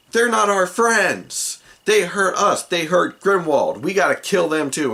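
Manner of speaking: high, loud outraged cry; rally the mob against the traitors; indignant goblins. Acted. Goblins hurt by the party turn on them, loud indignation.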